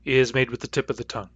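ordinary talk, nothing marked

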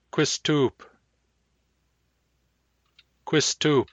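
rounded kw opening "Quistoop". Shown as a demonstration. Teacher giving the example word for round K, lip-rounded "Quistoop". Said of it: "Round K".

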